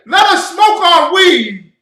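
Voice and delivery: high, loud and slow mocking whine; mock silly plea. Preacher mocking a silly request, high and loud. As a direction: lampoon a whiny request, laughing. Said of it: "They didn't say".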